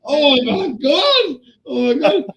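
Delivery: high, drawn-out horrified exclamation; retold shock. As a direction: act out being appalled, high and slow. Retold horror at seeing blood.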